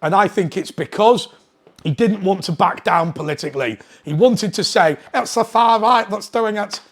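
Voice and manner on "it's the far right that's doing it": higher-pitched, badly faked politician's voice; mock politician, pitched up and unconvincing; bad Keir Starmer impersonation. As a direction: put on a poor mimicry of a politician. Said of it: "Sorry, can't do an impersonation of him".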